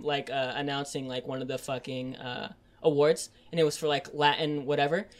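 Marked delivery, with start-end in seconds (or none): none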